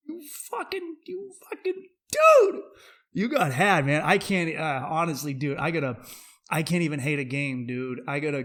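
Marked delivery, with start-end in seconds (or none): 0.1-4.0 high mocking squeal rising to a yell; mocking taunt. Friend mocking the guy who got played, high squealing taunt. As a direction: taunt him in a high put-on voice. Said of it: "I was like".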